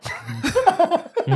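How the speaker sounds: disapproving hmm. Retold or imitated. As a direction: give a doubtful, loaded hmm. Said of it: "But if I did something wrong, he'd be like".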